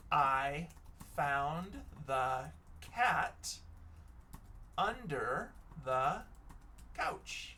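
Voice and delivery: slow, word by word with gaps; slow dictation. Shown as a demonstration. Teacher makes a sentence with under, slow dictation. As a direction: pause after each word as if writing.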